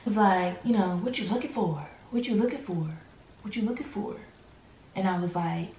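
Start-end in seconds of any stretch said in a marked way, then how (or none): 1.0-4.2 soft repeated prying question; mock nosy questioning. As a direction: imitate a nosy partner, softly and lightly. Partner's nosy repeated question, retold.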